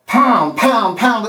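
forceful, pounding "pound"; pounding emphasis. Shown as a demonstration. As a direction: strike each "pound" like a hammer. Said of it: "it needs to be".

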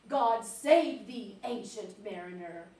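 very slow dramatic verse reading; strong opening, then hushed and drawn out; poem recitation. Acted. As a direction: recite it like a solemn old ballad. Reads poem aloud.

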